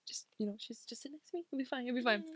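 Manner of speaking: very soft, hushed reassurance; barely-there soothing voice; calming. Retold or imitated. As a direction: murmur comfort to a panicking friend. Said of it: "I was trying to calm her down".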